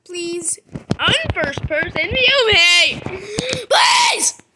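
pleading shriek, very high and loud; desperate cry. Real, not acted. Player begging the game not to kill him, shrieking. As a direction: beg at the top of the voice.

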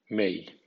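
may said the English way; English word may. Shown as a demonstration. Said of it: "o bien". (may en inglés)

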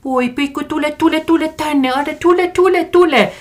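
very high-pitched mock cooing to a dog; imitated baby talk to pets. Someone talking to kids or dogs, high mock baby talk. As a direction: gush mockingly as if calling a little puppy. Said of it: "äänellä tai eri äänellä".

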